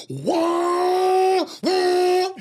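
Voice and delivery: high, loud hammed-up wail; mock screaming wail. Terrified passenger in the Corvette, loud high mock wail.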